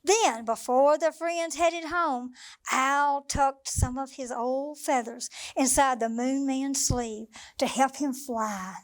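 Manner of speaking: usual speaking voice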